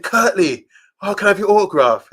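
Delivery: high, loud, eager call to a hero; retold breathless autograph request; out-of-breath fan plea. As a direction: call out as a worn-out young fan. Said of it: "I was so tired".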